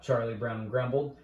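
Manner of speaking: plain reading voice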